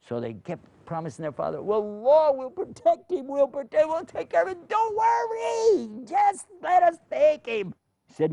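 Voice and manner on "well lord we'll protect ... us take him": high, pleading and loud, stretching out don't worry; pleading brothers. Acted. Brothers begging their father to let Benjamin go, high and pleading. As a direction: beg the old father to let him go.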